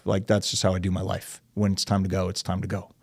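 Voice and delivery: normal talking voice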